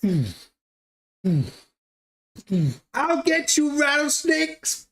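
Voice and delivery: hammed-up Texan gunman. Neighbor Butterstarch vowing to shoot the rattlesnake, a high mock shout. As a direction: play a cartoon Texan hunting a snake, high and yelling. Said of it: "he's just like".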